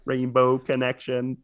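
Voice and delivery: lightly voiced robot puppet frog; Kermit the Frog robot. Shown as a demonstration. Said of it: "do a Kermit the Frog robot".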